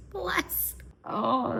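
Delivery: a high little coo of delight; squeaky aww. Real, not acted. Vlogger cooing high over the sweet couple.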